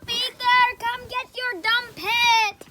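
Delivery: loud slow call; shouting. Acted. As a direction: call out across the house. Calling uncle to fetch pet.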